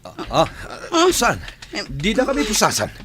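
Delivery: high, loud panicked cry; panicked protest. Acted. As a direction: scream out in shock as they cuff you. Being handcuffed by police. (naalarma a pukkaw)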